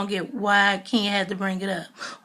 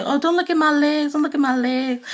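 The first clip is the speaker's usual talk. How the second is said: mocking Nene; 6 semitones higher than usual. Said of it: "Nene's just going around flexing".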